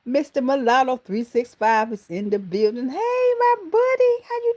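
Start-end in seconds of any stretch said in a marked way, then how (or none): none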